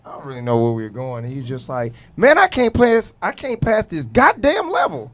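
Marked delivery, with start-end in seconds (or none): 2.2-5.1 put-on raised, whiny kid complaining; higher and louder, mock gamer rage; frustrated kid voice. Son complaining in retold story.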